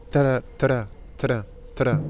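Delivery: "tra" with the tongue clacking from t into a tapped r; T-R drill. Shown as a demonstration. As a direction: drill "tra" crisply, tongue-tip R after the T. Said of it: "mettez un T devant".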